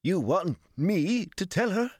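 high, strained, incredulous protest; aghast man after a coughing fit; wheezing disbelief. A narrator's reading. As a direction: protest in alarm, pitched right up. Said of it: "Gil wheezed once he could talk".

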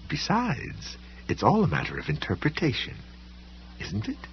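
high, soft, coaxing librarian voice; softly manoeuvring him; smoothly dismissive librarian. A narrator's reading. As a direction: start high and soft, tail off gently.

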